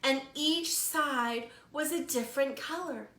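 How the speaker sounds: slow, lively storytime narration; animated picture-book storytelling; reading to children. A narrator's reading. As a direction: read it like a librarian to little kids. Librarian reads picture book aloud.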